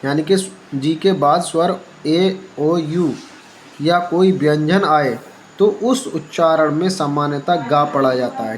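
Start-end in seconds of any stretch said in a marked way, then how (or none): none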